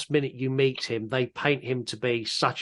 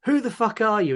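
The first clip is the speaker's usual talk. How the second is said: mock outrage, pitched up high; indignant challenge, higher but not shouted; retold angry outburst; 9 semitones higher than usual. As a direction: act out someone going off at a stranger. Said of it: "Doctor Grant really goes off at him".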